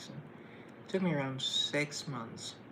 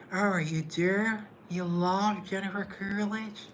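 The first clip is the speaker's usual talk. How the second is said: slow, high, drawn-out Coolidge purr; Jennifer Coolidge impression; 6 semitones higher, 5 dB louder, slower than usual. Vlogger answering a viewer in a slow, higher Coolidge voice. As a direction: stretch every word like Jennifer Coolidge. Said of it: "that's my impression".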